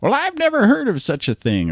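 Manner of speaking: high, creaky old man impression. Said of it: "kind of the old man voice".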